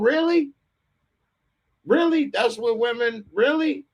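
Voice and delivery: high, disbelieving really; incredulous. Real, not acted. Ranting to friend about the worker, incredulous. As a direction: ask it like you can't believe it. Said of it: "incredulous rant".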